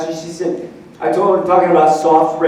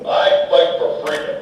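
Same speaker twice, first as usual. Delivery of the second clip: dramatic hero line; 3 semitones lower than usual. Pretend. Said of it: "what I do is go".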